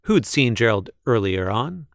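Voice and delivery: ordinary delivery, nothing marked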